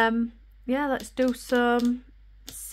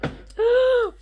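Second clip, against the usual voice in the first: sharp gasp; 14 semitones higher, 4 dB louder than usual. Real, not acted. Excited news of driving lesson.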